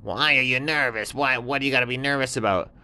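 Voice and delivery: high, sly little scorpion; high little scorpion voice, offering help. Acted. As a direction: squeak it out like a small sly critter.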